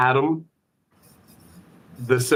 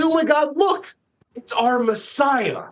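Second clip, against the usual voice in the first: awestruck cry; 11 semitones higher than usual. Acted. Colony spots a man flying above them, high awed cry. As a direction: marvel as if sighting a savior. Creatures see a flier overhead.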